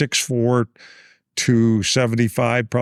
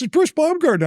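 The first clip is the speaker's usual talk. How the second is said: very high-pitched imitated caller; Bruce Baumgartner. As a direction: announce yourself way up high, as the caller. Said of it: "And he goes".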